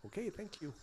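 high, soft mock thanks; clueless polite thanks. Baffled driver thanking the cop, soft feigned understanding. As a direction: imitate nodding along without understanding. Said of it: "of course I can't under".

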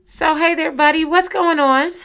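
high, loud, bubbly hello; excited greeting. Real, not acted. Mom greets her son on show.

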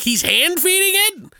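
very high-pitched disbelief; retold can't-believe-it reaction; incredulous. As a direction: squeak the question in mock astonishment. Retells own disbelief at the movie.

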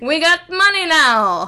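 loud, high brag; mock triumphant shout. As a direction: holler it in hammed-up triumph. Mock boast of sudden fame.

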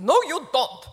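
put-on clipped German accent, loud and high. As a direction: answer flatly like an old German lady. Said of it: "in her German accent".

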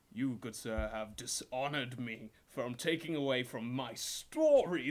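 loud, slow, wounded pride; indignant knight. Acted. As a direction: drag it out like an insulted old soldier. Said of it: "He says".

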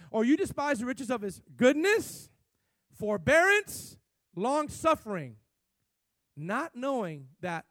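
loud, high and slow, every word stretched; preacher reading Romans to the church at full volume; preaching scripture. Acted. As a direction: proclaim it to the back row. Reads scripture at full force.

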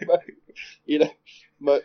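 ordinary delivery

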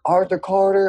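high-pitched schoolkid voice; imitated student. Student telling teacher his name, imitated high voice.

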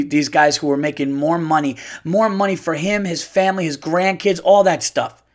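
ordinary talk

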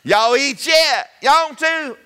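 slow, high, loud country drawl; broad Southern country accent, drawn out. Shown as a demonstration. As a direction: put on the thick Georgia country boy accent. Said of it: "I can still talk like that right there too".

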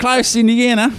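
high mock Aussie; put-on Australian voice; horrible Australian accent. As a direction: toss it out in bad, high Australian. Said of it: "I do a horrible Australian accent".

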